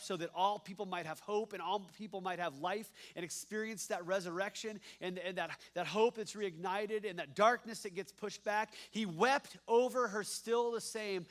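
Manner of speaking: ordinary delivery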